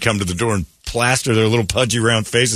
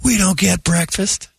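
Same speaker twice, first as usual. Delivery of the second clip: put-on kid voice; 11 semitones higher than usual. Kid begging for food.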